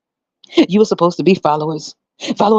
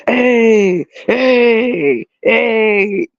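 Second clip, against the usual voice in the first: lightly voiced God calling out, higher; mock God calling; 6 semitones higher, much slower than usual.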